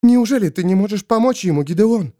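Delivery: fast, high-pitched challenge; insistent urgent appeal; demanding question. A narrator's reading. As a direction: press for an answer, quick and high. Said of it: "требовательно спросила Пейшенс Кыс".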